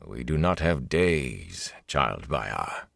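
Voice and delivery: quiet, unbothered command; mild lord captain. A narrator's reading. Lord captain gently overruling his officer, mild and low. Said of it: "the gray-haired man said mildly".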